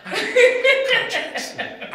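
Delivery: high squeal of laughter through the words; laughing. Real, not acted. Comedian laughing to the interviewer about contracts.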